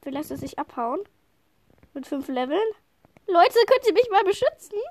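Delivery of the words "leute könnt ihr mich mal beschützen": loud, high call for help; urgent plea. Real, not acted. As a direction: shout to the team to protect her. Calling teammates for help in game.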